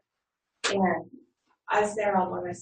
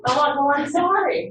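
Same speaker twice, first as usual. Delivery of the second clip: higher, louder, slow sorry through a laugh; laughing apology; 7 semitones higher, 7 dB louder, slower than usual. Retold or imitated. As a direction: apologize while cracking up. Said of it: "I'm like".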